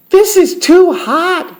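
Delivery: put-on very high, loud little-girl voice; Goldilocks complaining. A narrator's reading. Goldilocks tastes Papa Bear's porridge, high complaint. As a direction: cry out as a little girl tasting something too hot.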